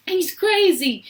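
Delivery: high, loud accusation; frightened shouting. A narrator's reading. Parents shouting about Wonka in the tunnel, frightened shout. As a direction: cry out in alarm. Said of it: "they shouted".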